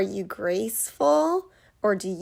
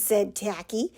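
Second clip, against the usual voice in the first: ordinary delivery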